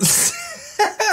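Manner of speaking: high-pitched burst of laughter. Real, not acted. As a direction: squeal of a laugh. Laughing at his shirt.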